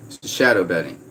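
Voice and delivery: ordinary talk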